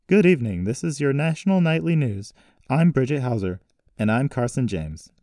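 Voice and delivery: loud, high broadcast greeting; announcer's sign-on delivery; news anchor. Acted. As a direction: open the nightly news, projecting. Voices television news anchors.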